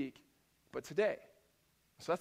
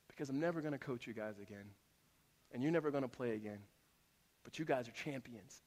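hushed, lower-pitched imitation of a coach; retold heartfelt coach; 7 semitones lower, 11 dB quieter than usual. Coach to crying twelve-year-old boys after losing, quietly heartfelt. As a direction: soften right down as the coach consoling his players. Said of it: "he is just passionately communicating them".

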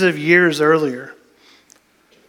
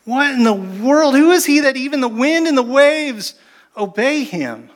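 high, astonished exclamation; amazed wonder; 7 semitones higher than usual. Retold or imitated. Disciples marvel the wind obeys him, in high wonder. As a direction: let the wonder spill out.

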